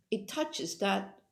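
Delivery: ordinary delivery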